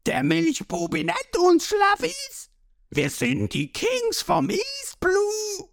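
high, strained, drawn-out shout; high-pitched pirate outrage; furious yell. A narrator's reading. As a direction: screech it indignantly from the crowd. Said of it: "einer aus der Menge schreit los".

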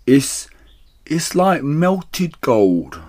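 high, slow, stammered wonder; rapturous gasp. A narrator's reading. Badger after gulping cider, slow rapturous gasp. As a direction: gasp it out, lost in bliss. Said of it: "he gasped".

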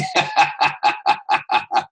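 loud, high laugh. Real, not acted. Preacher laughing at the image, loud laugh.

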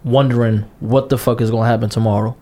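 ordinary talk

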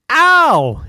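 sharp high yelp of pain; high ouch cry. Acted. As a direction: yelp like you just landed hard. Falls on his bum.

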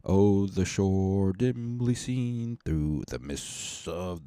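slow, soft, solemn reading of verse; poem recital. Acted. Podcaster reading anthem verses to listeners, slow hushed recital. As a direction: recite the old lyrics slowly and quietly.

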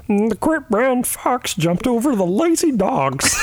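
high slow Kermit impression; Kermit the Frog. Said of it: "Yours is Kermit the Frog".

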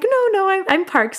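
high-pitched, hurried denial; imitated high, anxious "don't worry"; flustered reassurance. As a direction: reassure your friend in a high, retold voice. Hastily reassuring friend on the call.

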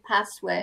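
"password" with a short flat A as in "cat". Shown as a demonstration. Teacher modelling own regional accent, short A in password. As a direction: say "password" as PASS-wud, short first vowel. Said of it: "I would say a password".

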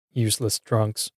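soft, dismissive aside to oneself; musing. A narrator's reading. Man passing drinkers at the store, musing. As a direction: muse it under your breath. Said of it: "I muse".

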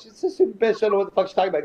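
high, exasperated dismissal; retold indignation. Fighter retelling his outburst about the nurse, indignant. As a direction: pitch up as you retell dismissing her.

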